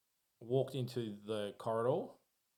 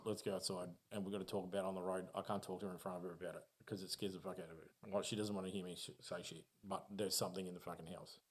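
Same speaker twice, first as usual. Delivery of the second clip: quick and softer, rushed; urgent hushed friend; 2 semitones lower, 6 dB quieter, faster than usual. Retold or imitated. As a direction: friend urging him outside, fast and hushed. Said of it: "And he goes".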